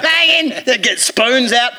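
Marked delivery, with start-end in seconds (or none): none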